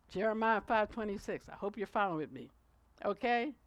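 ordinary talk, nothing marked

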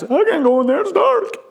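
put-on high, squeaky child's protest; mock childish whine; whining little boy. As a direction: imitate a scared kid, pitch climbing on the last words. Small son scared of the dark.

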